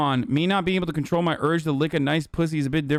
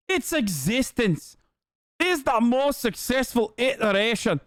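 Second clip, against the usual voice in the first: put-on Scottish caricature, pitched way up; Eve Fartlow impression, Scottish accent; 7 semitones higher than usual. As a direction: parody her in a high Scottish accent. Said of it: "my regular Eve Fartlow impression here".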